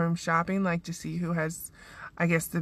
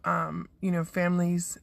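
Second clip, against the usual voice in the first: ordinary talk, nothing marked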